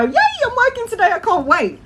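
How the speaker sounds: squeaky high, hammed-up joy; mock excitement. Waking up thrilled to go to work, high and excited. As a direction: chirp it like an eager kid. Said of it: "wake up in the morning and go".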